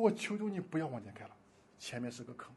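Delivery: soft, pleading beg; man on his knees begging the driver. Retold or imitated. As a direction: plead quietly with him. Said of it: "他就求他".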